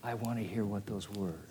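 quiet, wistful repeat of John's wish. Retold or imitated. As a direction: repeat it to yourself, almost under breath. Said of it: "John sitting there and going".

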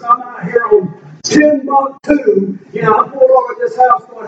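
ordinary talk, nothing marked

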